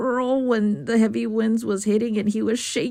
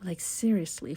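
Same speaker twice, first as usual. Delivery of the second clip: hushed whispered aside; whispering; 4 semitones lower, 7 dB quieter than usual. Real, not acted. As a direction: whisper it confidentially.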